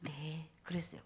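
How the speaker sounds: soft, hushed yes; meek reply. Retold or imitated. Volunteer meekly agreeing with the drunk man, soft and hushed. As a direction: answer him humbly and quietly. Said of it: "그래서 내가".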